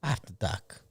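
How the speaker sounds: After doc with no r; Boston accent. Shown as a demonstration. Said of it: "you have to say doc".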